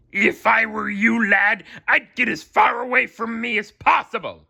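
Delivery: barked warning. A narrator's reading. Mister Krabs barking at SpongeBob after the fryer. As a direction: bark it like a furious boss. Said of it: "Mister Krabs barked".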